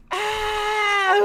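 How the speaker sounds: put-on high, loud toddler scream; child's scream. Dad mimicking his toddler screaming at the movie. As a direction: let out a shrill kid's shriek. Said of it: "And she's like".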